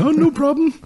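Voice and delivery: high-pitched, laughing imitation of backing down; mock meek compliance. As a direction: squeak it out as a cowardly climb-down. Mocking how friend would back off.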